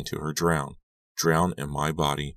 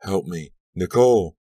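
distant call; 3 semitones higher, 6 dB louder than usual. A narrator's reading. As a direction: cry for help swelling louder and higher. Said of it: "Her voice floated up".

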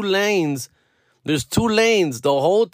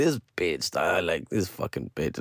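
much lower, much softer muttered insult; a low, hushed curse under the breath; 11 semitones lower, 9 dB quieter than usual. Retold or imitated. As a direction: grumble it to yourself so she can't hear. Quoting himself about angry woman.

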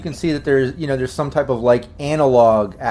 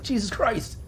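intimidated prisoner; 9 semitones higher, 8 dB quieter than usual. Acted. Game master as prisoner facing a gun, intimidated. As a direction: curse as a cornered captive. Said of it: "he seems a bit intimidated by that".

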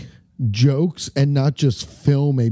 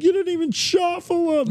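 high-pitched mock complaint; heckling crowd. Bingo players accusing the caller, high imitation heckle.

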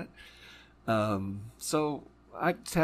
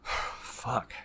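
exhaled curse; 4 dB quieter than usual. Real, not acted. Overwhelmed by a painful memory.

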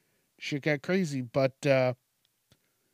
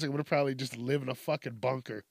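ordinary talk

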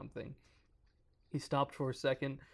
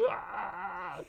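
startled cry; 7 semitones higher than usual. Real, not acted. Reader reacts to scary croak.

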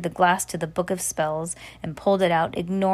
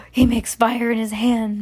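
ordinary delivery

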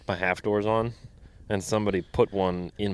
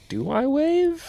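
high, doubtful little question; a confused newbie asking aloud; unsure high question; slower than usual. Retold or imitated. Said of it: "the people that are like".

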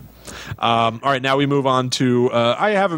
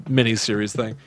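usual speaking voice

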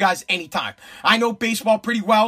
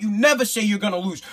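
ordinary talk, nothing marked

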